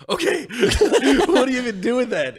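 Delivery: high, laughing disbelief; laughing doctors. Retold or imitated. Retelling doctors reacting to a dropped gun, high laughing disbelief. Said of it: "They're like".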